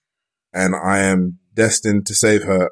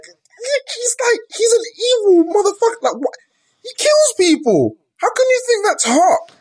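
high-pitched indignant yell; outraged shouting. Acted. Heated rant about Ganon.

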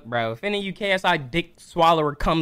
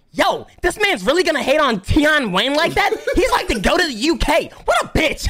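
high-pitched whiny commenter imitation; mock outraged fan; 9 semitones higher than usual. Reactor imitating fans in the comments, mock outrage. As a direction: mimic an indignant fan in high voice. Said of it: "K S I dick rider's gonna be in the comments".

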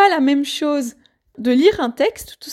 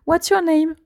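put-on higher-pitched English line with French accent; 3 semitones higher than usual. Teacher voicing a learner matching subtitle to film, French-accented English. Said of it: "j'ai un magnifique accent anglais".

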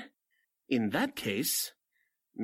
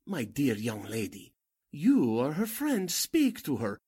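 normal talking voice

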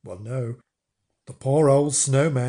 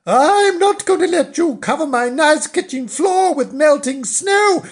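loud, high wifely protest; high-pitched scolding housewife; indignant cry; 12 semitones higher, 5 dB louder than usual. Acted. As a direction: refuse him at the top of your voice. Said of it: "she cried".

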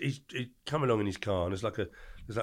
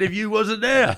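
high, loud punchline still laughing; 11 semitones higher, 10 dB louder, slower than usual. Real, not acted. To Alfie on the phone, cheeky punchline delivered laughing. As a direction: land the joke through a laugh.

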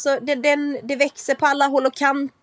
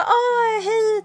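high sing-song mock hello; chirpy high greeting; 12 semitones higher than usual. Imitating her sister-in-law's chirpy phone hello. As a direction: mimic the over-sweet phone greeting, pitched way up.

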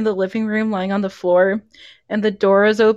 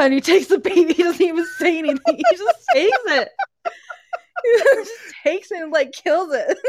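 talking through giggles, higher pitched; real laughing; 6 semitones higher than usual. Real, not acted. Retelling the baby killing, laughing helplessly. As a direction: crack up while telling it. Cracking up while retelling scene.

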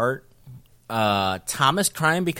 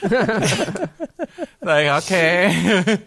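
laughing; 6 semitones higher, 4 dB louder, much slower than usual. Real, not acted. Laughs at own joke.

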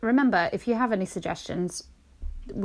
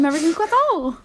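put-on Southern accent; 8 semitones higher, 3 dB louder, faster than usual. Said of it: "I don't know why I went fucking southern".

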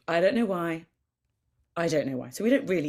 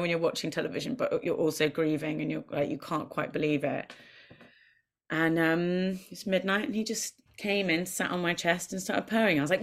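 ordinary talk, nothing marked

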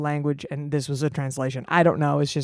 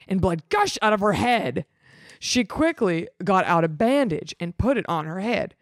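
ordinary talk, nothing marked

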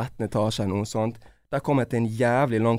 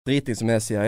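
ordinary delivery

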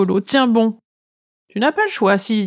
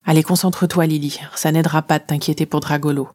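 ordinary delivery, nothing marked

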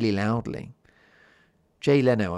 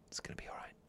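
soft breathy whisper of comfort; 19 dB quieter, much faster than usual. Shown as a demonstration. Host whispering comfort to listeners in bed, whispered. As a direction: whisper it like soothing someone to sleep. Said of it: "I whisper that".

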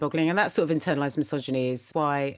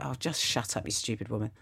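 muttered retort; 5 semitones lower, 7 dB quieter than usual. Retold or imitated. Imagined retort, then I didn't say that.

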